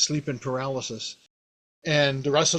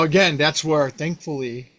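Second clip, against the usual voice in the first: normal talking voice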